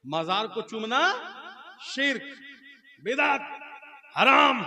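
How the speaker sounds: high staccato verdicts building to a shout; haranguing cleric. Acted. Preacher mimics cleric condemning shrine-kissing, emphatic and rising. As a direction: pause before each word and hit it harder. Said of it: "मौलवी रात भर तकरीर किया".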